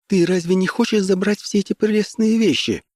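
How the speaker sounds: high feminine character voice; high tender woman's voice. A narrator's reading. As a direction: narrator voicing a woman, pitched far up. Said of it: "сказала Диана высоким нежным голосом".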